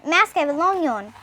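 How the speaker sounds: plain reading voice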